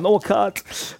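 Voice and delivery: mock tearful, high-pitched protest; high retold near-sob; fighting back tears. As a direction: imitate someone about to cry, voice pitched right up. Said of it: "shaking and fighting back the tears".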